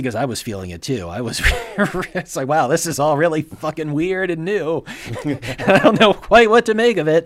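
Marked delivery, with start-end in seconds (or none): 5.0-7.3 laughing. Real, not acted. Laughing at the weird film.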